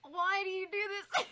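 overwhelmed pleading. Real, not acted. Mock protest at a generous viewer.

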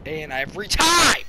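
loud, slow, stretched last word; hammed-up yell. Gamer caps the Josh bit, hammed-up drawn-out yell. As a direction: belt it out as a punchline.

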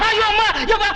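high, crying wail of despair. Acted. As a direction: howl out in panic, sobbing. Trapped, wailing in despair. (உயர்ந்த குரலில் அழுகை ஓலம்)

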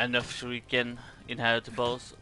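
normal talking voice

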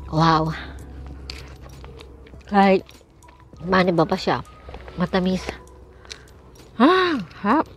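talking while eating fruit; words muffled by food; mouth full, chewing. Real, not acted. Seen 0: Bites and chews strawberries throughout, hand at mouth.

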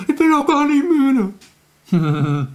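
high, slow, whining protest; whiny child. Retold or imitated. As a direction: stretch it like a sleepy kid refusing bed. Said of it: "Dann sagt ihr doch immer".

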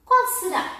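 put-on bright rising tease to kids; hint at a secret with a lift; playful high question. Teasing question to children. (pergunta brincalhona e aguda)

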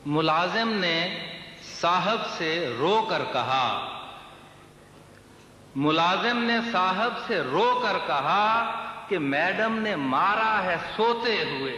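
measured mushaira declamation, words drawn out; lilting Urdu verse delivery; poetry recitation. Acted. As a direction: recite the couplet to a listening crowd. Recites servant's complaint verse.

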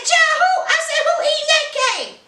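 high-pitched, incredulous squawk; mock disbelief. Host reacting to a tiny party cake, mock disbelief. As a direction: pitch it way up, astonished at the very idea.

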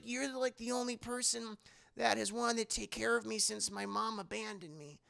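put-on high-pitched, earnest kid voice; pleading little girl. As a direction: plead like an abandoned child. Child pleads to stay.

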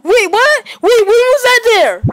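high, loud, shocked question; real astonished yelp; startled disbelief. Real, not acted. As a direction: blurt it out high, caught off guard. Startled by unseen game piece.

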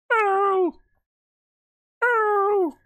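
very high, stretched falsetto hello; Nanagar. Retold or imitated. Gamer showing all the taunt does, falsetto impression. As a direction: squeal it as a repetitive game taunt. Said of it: "When you taunt as the Nanagar".

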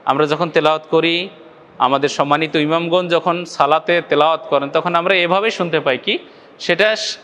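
ordinary talk

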